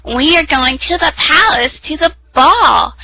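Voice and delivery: high and bright, slowing on ball; gloating stepsister. A narrator's reading.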